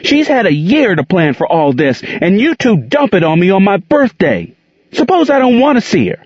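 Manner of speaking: put-on high, upset child speeding up; angry boy. A narrator's reading. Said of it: "Gerald cried angrily".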